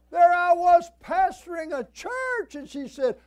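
high-pitched, booming preacher's protest; indignant shout. Retold or imitated. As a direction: shout it out in mock outrage. Mock indignation at mother's remark.

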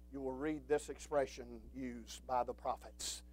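usual speaking voice

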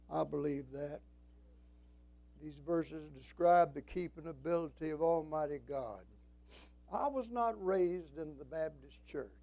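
ordinary talk, nothing marked

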